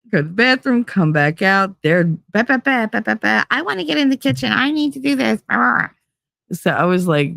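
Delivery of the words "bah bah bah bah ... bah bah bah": higher, fast bah-bah gibberish; mocking the kids who crowd the kitchen; mocking kids' chatter. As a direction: mimic their nagging as nonsense.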